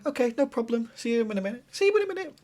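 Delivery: lightly voiced polite goodbye, high on the last line; a hint of a cheerful boyfriend. Shown as a demonstration. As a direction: show him how to answer nicely. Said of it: "Just say".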